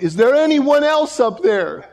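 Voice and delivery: high, loud comic yell. Retold or imitated. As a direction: yell up to the sky for someone else. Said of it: "he yells out".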